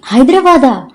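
high, excited shout of joy; thrilled cry. A narrator's reading. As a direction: cry it out in wild delight. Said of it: "వెర్రి ఆనందంతో అరిచింది". (వెర్రి ఆనందంతో అరుపు)

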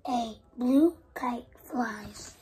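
ordinary delivery

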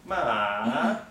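"Ma" in a long falling-then-rising tone; third tone. Shown as a demonstration. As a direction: start "Ma" high, sink down, then swing up. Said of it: "it begins high, goes down, and comes up".

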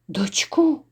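breathless whispered question; a sudden hushed gasp of a word; shocked whisper. Acted. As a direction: whisper it, stunned by the news. Stunned question about his beloved.

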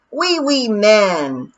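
loud, very slow gleeful yell; baby yelling. Acted. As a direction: shout it like a giggling toddler. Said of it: "he yelled".

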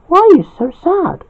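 high voiced wife, gently asking; Terry's voice. Terry asks Jack on phone.

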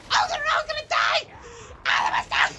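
very high, loud squealing cry; mock villager panic. Player mimicking villagers under attack, high mock panic. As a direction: shriek it like a doomed villager.

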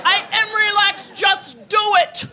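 slow loud stiff protest; tense shouted insistence. Retold or imitated. Woman stiff in bed insisting to her lover, loud and slow. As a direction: bark it slowly through tension.

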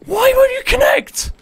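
high, strained yell at the game; frustrated shout. Real, not acted. Gamer yelling at the game that won't connect, frustrated shout.

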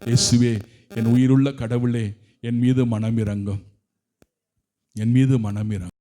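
low, intense plea; pleading for mercy. Real, not acted. Preacher begging Jesus for mercy in a low plea. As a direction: beg for mercy from deep down.

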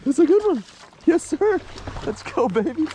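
squealing happy fishing cheer; thrilled shout. Real, not acted. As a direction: yell it out with real glee. Excited over fish catch.

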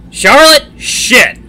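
loud, high-pitched exasperated shout; booming outburst at a teasing sister. A narrator's reading. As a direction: boom out the name in embarrassment. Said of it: "To go with his booming voice".

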